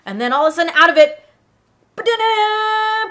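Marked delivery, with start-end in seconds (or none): 2.0-3.1 high, drawn-out vocal ta-da; stretched-out high mouth trumpet; fanfare noise. Retold or imitated. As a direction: mimic a little reveal fanfare. Imitated noise as aliens pop out.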